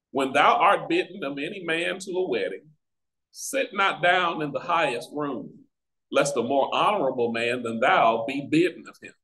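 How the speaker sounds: usual speaking voice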